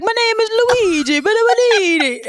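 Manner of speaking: mock Italian accent. Mocking Jared Leto's film role, hammed-up Italian accent. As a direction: whine it in an overdone Italian accent. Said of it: "he's literally like".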